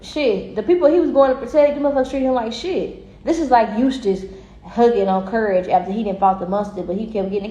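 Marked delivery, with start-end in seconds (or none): none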